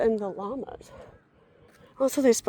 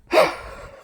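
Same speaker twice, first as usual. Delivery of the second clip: upset sigh; 8 semitones higher, 7 dB louder than usual. Real, not acted. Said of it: "I'm very upset about this".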